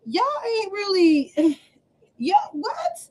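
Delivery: exasperated. Retold or imitated. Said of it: "when I be like".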